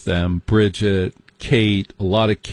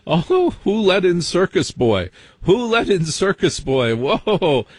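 hammed-up high shocked outcry; mock scandalized; 8 semitones higher, slower than usual. Guests horrified that Circus Boy got in, squealing high in mock shock.

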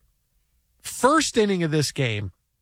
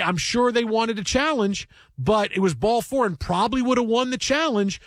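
ordinary talk

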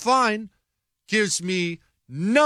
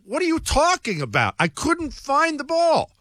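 higher-pitched, exasperated comeback; retold indignant protest; 8 semitones higher than usual. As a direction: protest your innocence, replaying the quarrel. Retold quarrel, defending himself.

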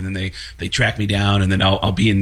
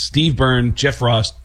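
ordinary talk, nothing marked

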